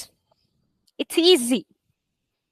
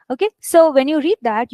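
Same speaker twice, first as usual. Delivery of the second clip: ordinary talk, nothing marked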